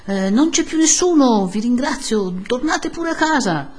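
high-pitched calling from a window; flustered priest. A narrator's reading. Priest sends the crowd home, flustered calling. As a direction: shoo them off politely from above.

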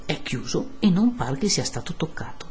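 usual speaking voice

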